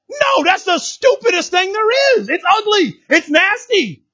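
high, slow shout of disgust; preaching shout. Real, not acted. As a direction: cry out against it like a preacher. Preacher condemning jealousy.

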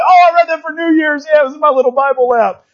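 a hint of a sheepish churchgoer; mock congregant. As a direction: tease the crowd by imitating them. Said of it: "You'll be like".

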